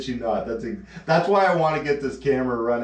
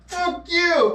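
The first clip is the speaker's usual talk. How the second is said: much higher, spat-out insult; mock angry retort; 3 dB louder than usual. [name] fed up with his vaccine joke, mock anger. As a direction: snap it at him.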